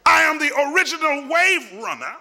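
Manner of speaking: high, driving declarations building in a rolling list; full-force preaching. Acted. Preacher voicing Jesus' claims to the church, full-force preaching. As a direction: proclaim each title with rising force.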